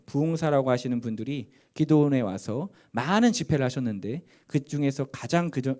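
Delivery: ordinary talk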